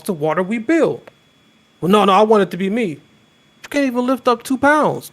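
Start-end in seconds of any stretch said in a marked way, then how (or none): 1.8-2.9 fast, loud, insistent protest; insistent whiny. Acted. Acted argument over who leads. 3.6-5.1 higher, scoffing put-down; mocking retort. Acted argument, other side.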